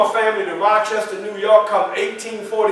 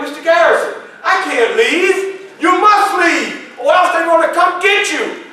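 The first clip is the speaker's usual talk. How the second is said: agitated protest; 8 semitones higher than usual. Acted. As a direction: argue back in alarm. Panicked exchange with Garrison.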